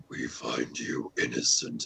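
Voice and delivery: talking mask. Retold or imitated. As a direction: intone it like a demonic mask. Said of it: "demonic mask voice from Crash Bandicoot".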